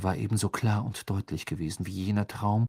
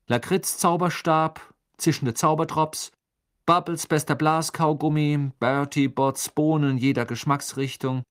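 raised, louder string of attempts; listing guesses; 6 semitones higher, 5 dB louder than usual. A narrator's reading. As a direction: call out one password try after another. Rattling off password guesses.

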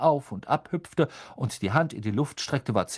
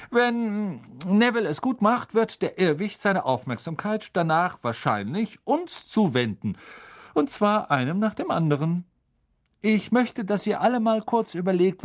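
higher, louder friendly professor voice; kind teacher; 8 semitones higher, 3 dB louder than usual. A narrator's reading. Teacher explaining the spell to the class, higher and friendly. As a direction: explain patiently like a gentle teacher.

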